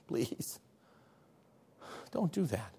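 near-whispered, halting plea; gentle pleading. Acted. Preacher who loves you begging you to stop, hushed tender plea. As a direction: beg very softly, pausing after please. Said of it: "the preacher who loves you and says".

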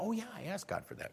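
quick, soft, casual aside; offhand realisation. Retold or imitated. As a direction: toss it off like it's no big deal. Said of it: "and went".

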